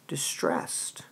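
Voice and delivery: whispered, distressed. Shown as a demonstration. Teacher whispers the word to show Juliet's distress. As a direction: breathe it out in distress. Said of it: "sounds completely".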